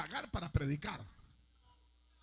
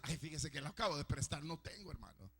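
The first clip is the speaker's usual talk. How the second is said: softer, lower whiny excuse; mock excuse; 7 semitones lower, 7 dB quieter than usual. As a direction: play the stingy brother dodging. Mocking a stingy churchgoer's excuse.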